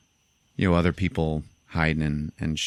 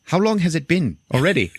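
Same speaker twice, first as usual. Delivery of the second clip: high, a little louder, alarmed; panicked high question; 10 semitones higher, 4 dB louder than usual. Retold or imitated. Charlto panics to Army about bleeding, high mock alarm. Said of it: "He's like".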